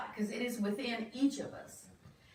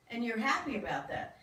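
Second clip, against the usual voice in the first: ordinary talk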